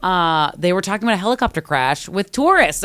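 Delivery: ordinary talk, nothing marked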